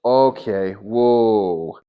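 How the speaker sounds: loud, startled okay, whoa. Retold or imitated. Telling God he balks at hugging a stranger, startled and loud. Shocked at being told to hug.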